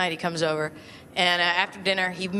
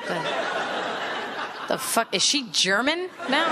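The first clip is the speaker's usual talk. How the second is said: baffled viewer; 5 semitones higher than usual. Pretend. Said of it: "Here's my impression of somebody watching Kathleen Turner".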